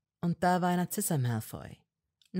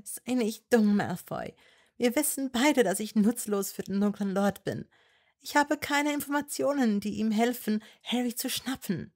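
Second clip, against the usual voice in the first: put-on higher young woman's voice, pleading and firm; Hermine voice; 6 semitones higher, 3 dB louder than usual. A narrator's reading. Hermine to Malfoy, higher and insistent. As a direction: argue with him, voice raised high.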